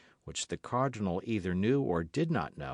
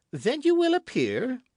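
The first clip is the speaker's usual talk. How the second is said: much higher, louder insistent question; demanding king; 7 dB louder than usual. A narrator's reading. King demanding the queen appear, insistent. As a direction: demand her answer.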